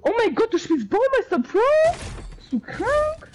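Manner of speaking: incredulous outburst. Real, not acted. Incredulous at teammate.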